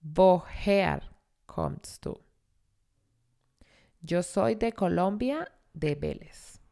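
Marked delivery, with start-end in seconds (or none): none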